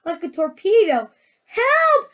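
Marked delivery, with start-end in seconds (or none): 1.5-2.0 very high, loud screams; screaming. A narrator's reading. Mrs. Gloop as her son shoots upward, screaming high. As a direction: scream each word separately. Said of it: "screamed Missus Gloop".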